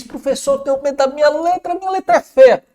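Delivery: mock fretful pupil, pitched high; worried student. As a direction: imitate a nervous student complaining. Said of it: "Muito aluno diz".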